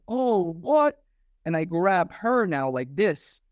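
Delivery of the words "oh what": shocked. Retold or imitated. Shocked cop seeing stabbing.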